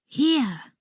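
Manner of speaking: ordinary delivery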